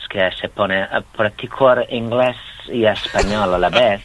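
lightly imitated English accent in Spanish; slow Spanish with an English accent. Shown as a demonstration. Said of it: "cuando habla [name]".

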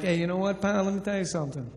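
quiet, level tough-guy talk; soft mock menace, eye to eye. Squaring up quietly to the bartender.

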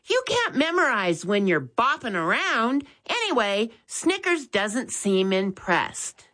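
put-on a young boy reminding his brother; Max's voice. A narrator's reading. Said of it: "Max suddenly noticed".

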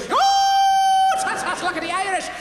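high, loud Irish accent; laughing stagey Irish accent. Shown as a demonstration. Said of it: "from Newfie to Irish".

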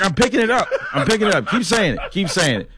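mock eager learner. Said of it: "I'd be like".

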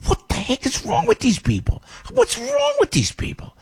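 high exasperated wail; exasperated outburst. Real, not acted. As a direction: throw up your hands in outrage. Outraged at governor's spending.

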